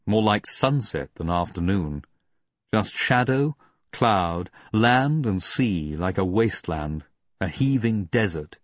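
plain reading voice

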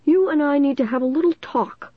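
high-pitched stern teacher; Mister Northwood, stern. A narrator's reading. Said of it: "Mister Northwood said sternly".

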